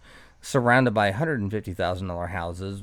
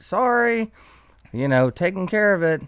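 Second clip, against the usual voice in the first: high, mock apologetic sorry; retelling an apology to the yelling neighbor, high and sheepish; sheepish high reply; 10 semitones higher, 5 dB louder than usual. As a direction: play it innocent, voice up high.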